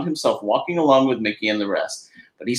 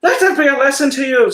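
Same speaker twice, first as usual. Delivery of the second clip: higher, louder scolding; smug leprechaun; 6 dB louder than usual. Acted. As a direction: teach the duck a lesson, pleased. Patrick lectures Donald.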